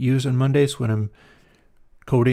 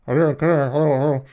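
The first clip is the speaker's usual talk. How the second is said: put-on old TV broadcast sound; 3 dB louder than usual. Shown as a demonstration. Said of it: "sound like an old T V broadcast".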